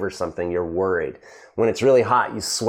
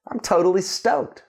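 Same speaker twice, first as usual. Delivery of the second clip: higher-pitched acted delight; happy, stoked; 8 semitones higher than usual. Teacher showing students what being stoked is, happily excited. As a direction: play it thrilled, pitch lifted. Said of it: "When you're happy, you're stoked".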